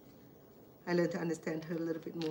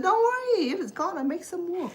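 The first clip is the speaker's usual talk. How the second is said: much higher and louder, reassuring; loud reassurance; 12 semitones higher, 8 dB louder than usual. Retold or imitated. To her worried sister, loud high reassurance. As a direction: call it out to calm her down.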